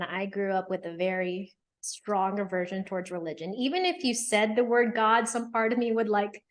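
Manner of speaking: ordinary talk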